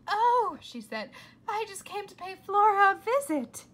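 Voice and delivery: high, loud fake-cheerful voice; fake sweetness. A narrator's reading. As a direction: beam a false grown-up smile. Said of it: "big fake adult smile back".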